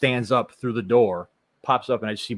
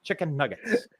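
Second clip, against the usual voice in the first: put-on Chicago accent; 7 semitones higher, 3 dB louder than usual. Jokey Chicago-accent riff continues.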